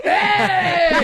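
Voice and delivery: high, drawn-out mock shout; hammed-up celebratory holler; Three Amigos cheer. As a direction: whoop it like a cartoon bandit. Mimics Three Amigos stereotype cheer.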